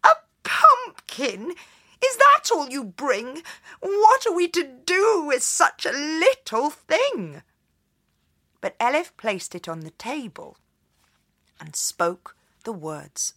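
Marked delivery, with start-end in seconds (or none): 0.0-7.4 high, loud scolding mother; cross mother. A narrator's reading. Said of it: "her mother was very cross".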